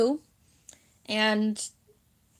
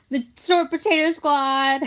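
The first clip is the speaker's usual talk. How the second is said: loud, high mock cheer; jokey, raised-voice rallying cry; playful shout; 5 semitones higher, 6 dB louder than usual. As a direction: call it out like a silly squad chant. Mock squad cheer amid laughter.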